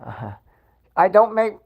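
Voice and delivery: ordinary talk, nothing marked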